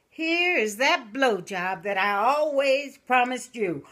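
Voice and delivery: put-on sly old widow punchline. Widow delivering the last promise to George's urn, slow and sly. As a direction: savor the punchline slowly. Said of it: "And she said".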